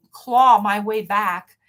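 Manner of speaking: "claw my way back" drawn out slowly and hit hard; slow, heavy stress on every word of "claw my way back"; slow emphatic. Real, not acted. Telling a listener about her struggle, slow and emphatic. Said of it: "I had to literally crawl".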